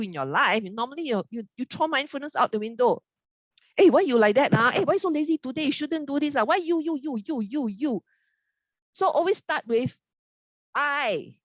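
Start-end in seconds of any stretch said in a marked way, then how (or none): 3.8-8.0 high-pitched exasperated scolding of a lazy spouse; irritated nagging. Retold or imitated. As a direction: nag your partner, pitch up and accusing. Nagging spouse without mindfulness.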